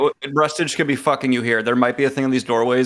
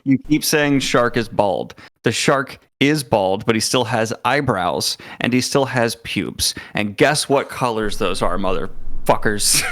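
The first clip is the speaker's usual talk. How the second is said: slow emphatic rant; slower than usual. Real, not acted.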